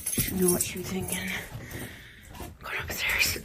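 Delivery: unvoiced whisper. Real, not acted. Whispering to herself on the way upstairs. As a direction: keep it to a breath.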